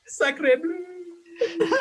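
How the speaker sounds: high falling cry in mock French; French chef. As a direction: wail it while falling away. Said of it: "You just hear Echo as he falls".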